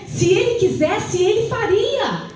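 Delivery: impassioned church proclamation; fervent preaching. Real, not acted. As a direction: urge the congregation to believe, pitched high. Exhorts the congregation with fervour.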